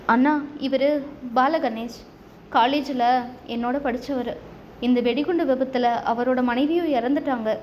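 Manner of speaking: ordinary delivery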